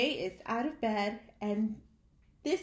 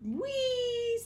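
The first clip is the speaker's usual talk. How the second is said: excited; 13 semitones higher than usual. Acted. Excited letter shouts in story.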